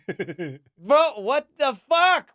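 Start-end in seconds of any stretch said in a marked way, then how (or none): 1.2-2.3 outraged exclamation. Real, not acted. Outraged at age question.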